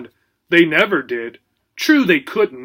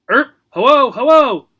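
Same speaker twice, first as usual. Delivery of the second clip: high, loud squawking parrot hello; bright bird squawk, cheerful; 8 semitones higher, 7 dB louder than usual. A narrator's reading. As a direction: parrot chirping hello at the gerbil.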